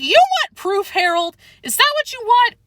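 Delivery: high, loud, indignant demand; heated challenge. Acted. Chester challenges narrator heatedly.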